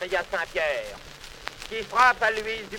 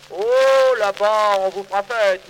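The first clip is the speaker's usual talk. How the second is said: sarcastic outburst; 7 dB louder than usual. Acted. Saint Peter mocks, sending him below.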